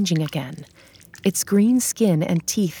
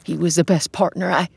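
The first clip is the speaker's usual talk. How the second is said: usual speaking voice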